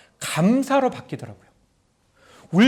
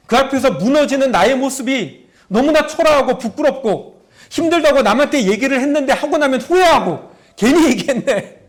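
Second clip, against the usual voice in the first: ordinary talk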